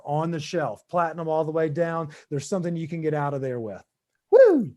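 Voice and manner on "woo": loud high whoop of relief; a loud, high, joyful whoop; excited whoop. Real, not acted. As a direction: let out a big celebratory woo. Host whoops after reading the ads.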